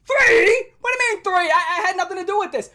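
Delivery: loud, high, cracking shout of disbelief; outraged protest. Retold or imitated. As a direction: cry out that it's unfair. Protesting being picked by teacher.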